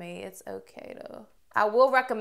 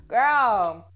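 sassy exclaim; 9 dB louder than usual. Real, not acted.

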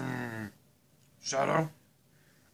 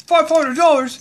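very high, very loud shocked cry; outraged disbelief; 13 semitones higher, 14 dB louder than usual. Pretend. Dad told the price, loud shocked disbelief. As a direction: scream at the price.

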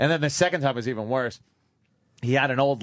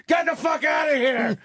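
angry shout; 13 semitones higher than usual. Retold or imitated. Crying dad snapping at his son, angry shout. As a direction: bark him out of the room. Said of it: "He goes".

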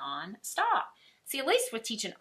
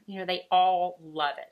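usual speaking voice

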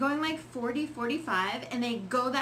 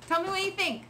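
normal talking voice

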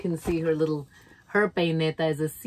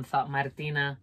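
usual speaking voice

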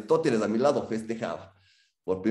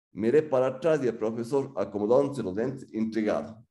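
ordinary delivery

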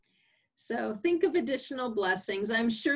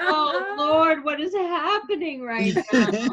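higher and louder, a stunned plea; exasperated disbelief; 6 semitones higher, 8 dB louder, slower than usual. Retold or imitated. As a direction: cry out to the Lord in disbelief. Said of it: "And I'm thinking".

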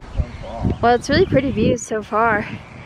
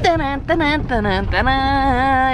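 sung trumpet fanfare, slowing down; teasing fanfare build-up; mock fanfare; 4 semitones higher than usual. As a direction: hum a playful drumroll fanfare. Fanfare before big news.

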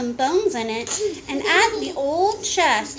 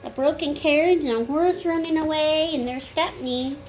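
usual speaking voice

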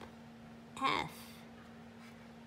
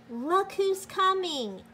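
high, louder eager announcement; excited call; 10 semitones higher, 6 dB louder than usual. A narrator's reading. Reader to children announcing more letters, eager high call. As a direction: call it out like news nobody expected.

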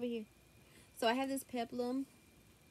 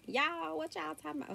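put-on thick Southern accent; 7 semitones higher than usual. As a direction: play up a thick Southern accent on a high-pitched retort. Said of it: "such a thick Southern accent".

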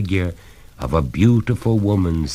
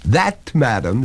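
measured master, pitch a little up; deliberate and unhurried address; slowly; 6 semitones higher than usual. A narrator's reading. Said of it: "said the master slowly".

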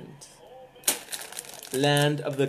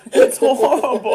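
high, slow laughing complaint; 9 semitones higher, 8 dB louder, slower than usual. Real, not acted. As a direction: laugh through the disgust. Tasting awful candy, laughing.

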